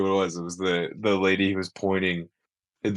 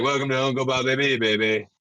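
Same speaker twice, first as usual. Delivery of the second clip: lightly imitated used-car salesman; Bernie Mac; 4 semitones higher than usual. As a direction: give a touch of salesman patter. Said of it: "And he's like".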